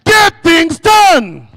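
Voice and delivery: high, loud, slow rally shout; with energy. Shown as a demonstration. Speaker shows the crowd how to say it with energy, shouting. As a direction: give it all your youthful energy. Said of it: "say it with energy".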